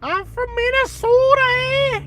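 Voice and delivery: very high, loud mock Minnesotan; Minnesota accent. Mocking the worst accent ever, shrieky Minnesota accent. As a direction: exaggerate the long Minnesota O. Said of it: "like, the worst accent ever".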